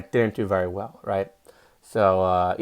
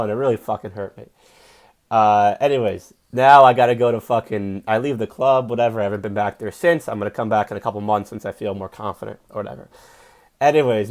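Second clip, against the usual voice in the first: normal talking voice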